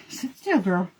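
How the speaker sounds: soft command to dog. Real, not acted. Soothing the dog quietly.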